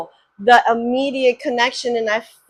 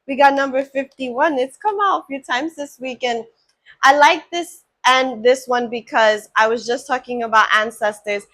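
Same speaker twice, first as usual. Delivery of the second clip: usual speaking voice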